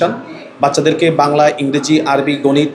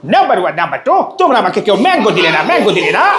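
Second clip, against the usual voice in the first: high-pitched cartoon character voice; high, playful cartoon mimicry; Motu Patlu impression; 5 semitones higher than usual. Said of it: "মোটু পাতলুর কণ্ঠ নকল করা".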